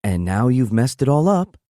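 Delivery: put-on sassy girlfriend voice; eye-rolling. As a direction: roll your eyes at him. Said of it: "She turns to look".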